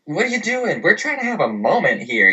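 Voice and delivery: high-pitched retold exasperation; mock-indignant protest; annoyed outburst. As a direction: act out snapping at someone who barged in.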